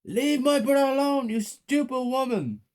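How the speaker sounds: very high, loud furious scream. A narrator's reading. Child defending brother from mother, screaming. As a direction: scream in defence, pitch right up. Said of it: "and then I screamed".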